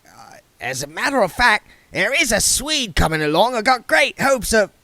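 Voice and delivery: high, loud jolly busybody; cheerful voice. A narrator's reading. Mister Wintler greeting William with cheerful gusto. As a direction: boom it out cheerfully. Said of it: "Mister Wintler began".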